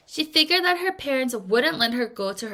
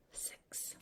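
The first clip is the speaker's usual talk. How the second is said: whispered number; 27 dB quieter than usual. Real, not acted. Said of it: "whispered number".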